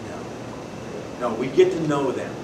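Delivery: normal talking voice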